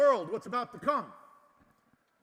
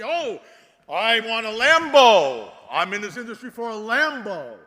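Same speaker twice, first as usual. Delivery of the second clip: loud, slow, drawn-out whine of "I want a Lambo"; mocking greedy voice; 15 dB louder than usual. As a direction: parody a greedy crypto bro. Mocking greedy industry people.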